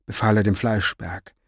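ordinary delivery

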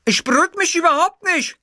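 high, loud shrieking refusal; shrill yell. Acted. Witzgür shrieking at the producer who tries to calm him. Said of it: "gellte Witzgür".

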